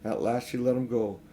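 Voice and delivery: ordinary delivery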